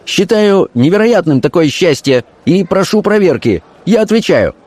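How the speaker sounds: high-pitched fervent insistence; hot-headed. A narrator's reading. Officer standing by his accusation, hot-headed. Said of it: "запальчиво произнёс офицер".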